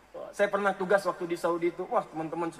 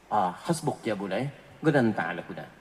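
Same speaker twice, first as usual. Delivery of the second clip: put-on much lower, gruff Arabic-accented dismissal; 12 semitones lower than usual. Syekh Ali dismisses the student after Al-Fatihah, much lower. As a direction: drop low like a stern old sheikh. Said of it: "dengan Syekh Ali".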